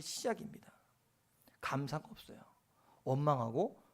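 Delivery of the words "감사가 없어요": normal talking voice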